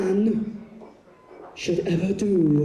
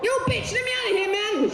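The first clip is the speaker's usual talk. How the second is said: very high squeaky protest; trapped tiny man. Acted. Tiny star shut in handbag, squeaky outrage. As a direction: squeal indignantly like a miniature man.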